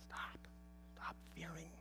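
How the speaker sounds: breathy whisper, barely voiced; whispered comfort from God; hushed reassurance. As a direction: hush them like a frightened child. God gently quieting fearful disciples.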